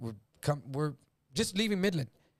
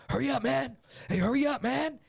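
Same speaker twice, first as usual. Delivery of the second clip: urgent hurry; 9 semitones higher than usual. Retold or imitated. Freddie urging Mark during robbery.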